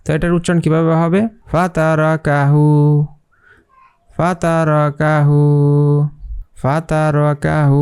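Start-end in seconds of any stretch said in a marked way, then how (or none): 1.5-7.8 ফাতারাকাহু said slowly, each long a held; one alif stretch. Shown as a demonstration. Teacher modelling ফাতারাকাহু slowly for the class. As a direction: spell out ফাতারাকাহু slowly, syllable by syllable. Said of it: "এইভাবে এটার উচ্চারণ করতে হবে".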